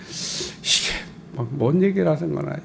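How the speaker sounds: 숨죽여 중얼거리듯 묻기; 어리둥절해서 나직이 혼잣말; 혼잣말하듯 작게. Retold or imitated. 베드로처럼 혼잣말. (환상을 못 알아들은 베드로가 작게 혼잣말)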